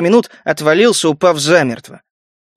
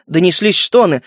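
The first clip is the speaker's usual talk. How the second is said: ordinary delivery, nothing marked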